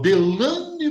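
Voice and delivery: name swooping high and drawn out; theatrical astonished call. Retold or imitated. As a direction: imitate her dramatic "Delane!". Repeats her grave revelation. (chamado teatral de espanto)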